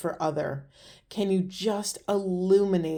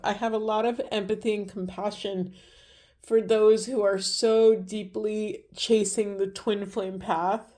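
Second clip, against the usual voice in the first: ordinary talk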